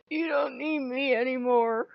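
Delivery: hammed-up teary lament; mock tearful. Owner teasing Loki with mock tearful sadness. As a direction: play wounded, near mock tears. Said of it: "I just looked at him and went".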